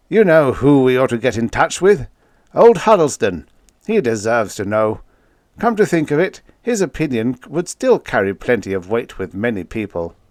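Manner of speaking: an affable president leaning in; a hint of a genial elderly statesman; President Collins. A narrator's reading. Collins suggests Huddleston.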